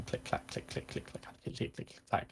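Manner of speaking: whispered, voiceless click-clack patter; imitated machine clicking. Shown as a demonstration. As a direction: make tiny mechanical clicks with the words. Game master imitates the clicking noise.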